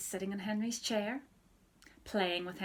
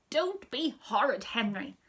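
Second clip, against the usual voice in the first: loud stern rebuke; shouting; 3 semitones higher, 8 dB louder than usual. Acted. Mum shouts at Henry again, loud rebuke. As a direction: bark the rebuke at him. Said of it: "shouted Mum".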